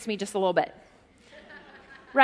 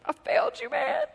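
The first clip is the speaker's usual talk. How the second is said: much higher, small cartoon dog voice; a sorry little dog; sad dog voice; 13 semitones higher than usual. Dejected dog says sorry to its owner, high small voice.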